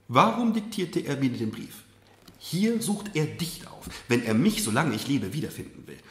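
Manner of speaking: a hint of a stern, firm mother. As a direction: lay out the terms firmly. Mother stubbornly defends father.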